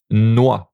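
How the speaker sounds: noix as "nwa", standard French; international French. Shown as a demonstration. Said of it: "The international French".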